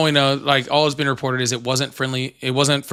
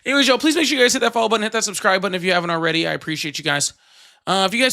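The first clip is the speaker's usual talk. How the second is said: higher, fast sign-off pitch; upbeat outro; 7 semitones higher, much faster than usual. Acted. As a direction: rattle off the subscribe plug. Outro call to subscribe.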